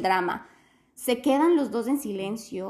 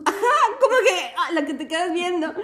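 high, loud laughing through words; real laugh breaking out; bursting laugh; 7 semitones higher, 5 dB louder than usual. Real, not acted. Laughing at the friend's comeback, high and loud.

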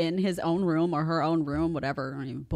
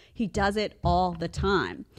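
measured, drawn-out statement in usual pitch; slow deliberate repeat; slower than usual. Real, not acted. Mom repeats complaint to viewers, slow and settled. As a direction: bring it down and say it slowly.

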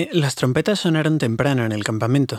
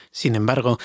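ordinary delivery, nothing marked

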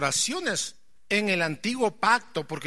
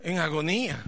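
high, loud fervent preaching; full-force preaching; 9 semitones higher, 7 dB louder than usual. Acted. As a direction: preach it out to the congregation. (predicación a todo pulmón)

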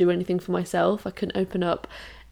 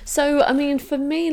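ordinary delivery

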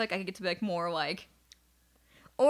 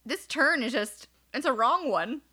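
horrified; 4 semitones higher, slower than usual. Retold or imitated. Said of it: "so horrified that I was just like".

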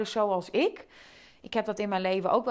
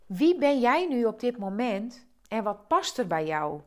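ordinary delivery

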